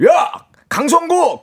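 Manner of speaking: high, slow call of the full name; angry wife yelling name. Retold or imitated. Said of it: "싸울 때 아내가 저한테".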